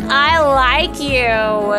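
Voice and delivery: slow, drawn-out tipsy confession; adorably drunk. Pretend. Imitating a tipsy crush confessing they like someone, adorably drunk. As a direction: slur it sweetly, stretching each word. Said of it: "get adorably drunk and be like".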